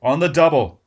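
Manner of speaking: loud barked command; shouted order. Acted. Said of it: "Barnes shouted".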